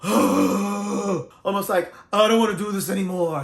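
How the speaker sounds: slow, loud exasperated sigh-speech; sighing out breath. Shown as a demonstration. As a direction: let it all out like you're fed up. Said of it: "you're letting all your breath out".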